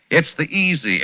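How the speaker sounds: ordinary talk, nothing marked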